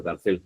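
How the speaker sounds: "cárcel" as car-CEL, stressed on the last syllable; misplaced final stress. Shown as a demonstration. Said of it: "Está mal dicho".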